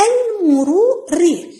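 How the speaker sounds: المروري slow and stretched, each syllable apart. Shown as a demonstration. Teacher sounding out the traffic word for pupils, syllable by syllable. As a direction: break المروري into long separate pieces. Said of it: "راح نقطع كلمات الدرس".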